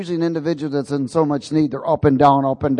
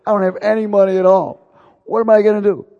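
louder, higher pleading cry; crying out in retold despair; desperate plea; 6 semitones higher, 7 dB louder than usual. Broke man pleading with God after the dentist, louder desperate cry.

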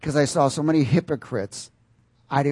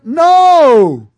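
huge, high shouted rebuke; shouted refusal; 13 semitones higher, 15 dB louder than usual. Acted. Spirit man refusing the unruly soul, booming shout. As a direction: shout it down with full force.

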